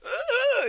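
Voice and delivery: very high, loud squeal; imitated burst of squealing; mock squeal. Voices Peridot's happy squeal.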